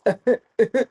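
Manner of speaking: loud high laugh; burst of laughter. Real, not acted. Traveler cracking up at the officers' joke, loud laugh.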